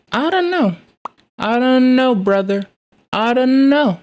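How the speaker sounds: hammed-up high-voiced uncertainty; mock doubt. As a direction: stretch it out high, playfully skeptical. Drawn-out mock doubt about the outcome.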